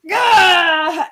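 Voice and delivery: high, loud startled shout; mock startled yell. Tyler jolting up in bed with a shout. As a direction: yelp as if jolted awake.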